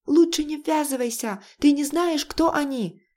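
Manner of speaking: high, urgent warning; panicked warning. Acted. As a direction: warn him off, pitch way up. Michael warns in panic.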